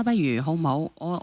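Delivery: normal talking voice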